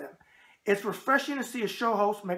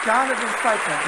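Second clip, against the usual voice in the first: loud announced slogan; announcer shout; 3 semitones higher, 8 dB louder than usual. Acted. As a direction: boom it out like a fight promo. Reading shirt slogan aloud.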